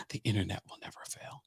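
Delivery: soft whispered aside. Real, not acted. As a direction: whisper it to yourself, amused.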